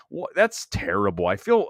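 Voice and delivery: ordinary delivery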